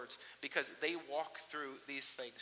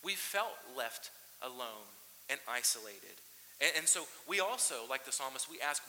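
ordinary talk

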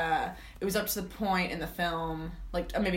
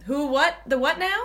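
confused; 6 semitones higher, 4 dB louder than usual. Retold or imitated. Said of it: "I said".

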